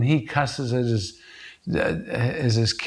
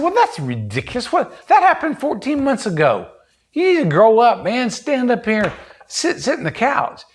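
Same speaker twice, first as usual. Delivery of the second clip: high-pitched, impatient dressing-down, getting louder; mock scolding; 9 semitones higher, 3 dB louder than usual. Therapist pretends to order a frightened veteran up, mock scolding. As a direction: act out scoffing, then rising into bossy orders. Acts out scolding a traumatized client.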